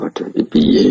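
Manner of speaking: bisikan bingung; Munkar Nakir bingung. Acted. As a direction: bisikkan seolah kebingungan. Munkar Nakir bewildered, whispered. (Munkar Nakir berbisik bingung)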